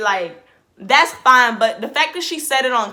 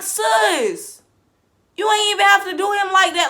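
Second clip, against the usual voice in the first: high disbelief; 9 semitones higher than usual. Retold or imitated. Shocked reaction to Giannia.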